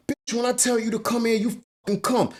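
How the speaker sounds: high-pitched, fast retold tirade; acting out a furious command without added volume; imitated angry abuser. Retelling [name] yelling at [name].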